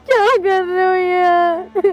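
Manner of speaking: slow, drawn-out tearful wail; crying protest. Acted. Daughter breaking down before her mother, crying. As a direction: wail it through tears, dragging each word.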